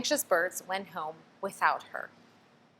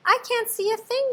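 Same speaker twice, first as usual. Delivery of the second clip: yelling; 4 dB louder than usual. A narrator's reading. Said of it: "yelled Pip".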